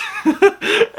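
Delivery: real high giggle; high burst of laughter. Real, not acted. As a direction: crack up at the joke. Laughing at own impression.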